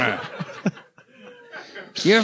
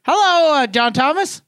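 ventriloquist host greeting; 6 semitones higher than usual. Pretend. Ventriloquist act greeting dummy.

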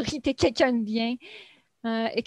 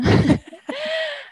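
éclat de rire aigu; 4 dB louder than usual. Real, not acted. Rire après « plus zen ».

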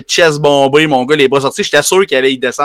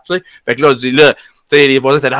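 ordinary delivery

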